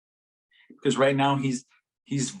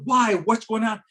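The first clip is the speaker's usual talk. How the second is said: higher-pitched mock demand; fretting teachers; 8 semitones higher, 4 dB louder than usual. Imitating teachers at the bawling child, fretting. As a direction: press for answers as a worried teacher, pitch up. Teachers questioning the student, acted.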